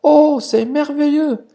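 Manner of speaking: put-on high whispered old lady voice; frail grandma. (grand-mère fragile, voix chuchotée et aiguë)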